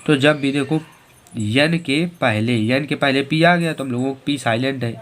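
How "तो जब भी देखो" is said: ordinary talk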